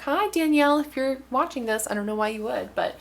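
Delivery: ordinary talk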